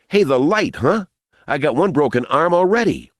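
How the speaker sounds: put-on high, aggrieved young voice; boy complaining. A narrator's reading. Boy who stumbled in dark, complaining.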